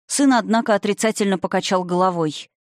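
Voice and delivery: plain reading voice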